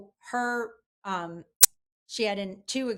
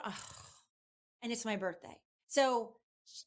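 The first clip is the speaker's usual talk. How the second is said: ordinary talk, nothing marked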